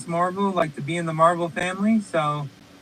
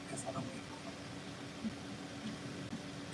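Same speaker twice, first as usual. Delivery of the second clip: whispered; 23 dB quieter, much slower than usual. Real, not acted. Muttered aside after stating motto.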